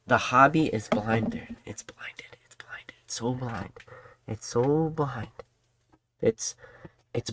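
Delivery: a soft, unvoiced whisper, slow. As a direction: whisper it like a secret nobody should hear. Whispered secret about the hobby.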